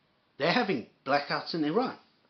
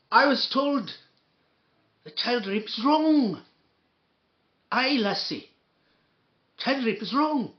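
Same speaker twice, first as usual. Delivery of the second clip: higher, slow, mocking Scottish voice; mock Scottish accent; 6 semitones higher than usual. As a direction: ape him in a high, mocking Scottish accent. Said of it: "I wanna do his accent".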